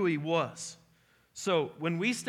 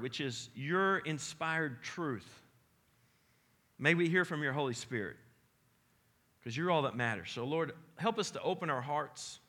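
softer, lower prayer voice; pastor's prayer; 4 semitones lower, 3 dB quieter than usual. Acted. Pastor praying for the congregation before the word, hushed prayer. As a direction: pray quietly and earnestly.

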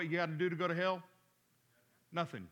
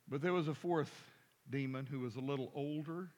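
ordinary delivery

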